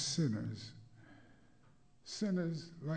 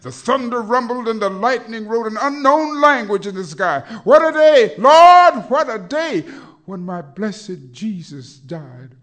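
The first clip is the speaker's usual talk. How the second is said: very high, very loud, fast cry; thundering shout; 6 semitones higher, 20 dB louder than usual. Acted. Thunder and lament climax.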